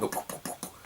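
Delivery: breathy whispered pop pop pop, like button presses; unvoiced mimic of a button clicking. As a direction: whisper the button-mash noises softly. Button mashing sound effect.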